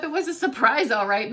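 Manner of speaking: plain reading voice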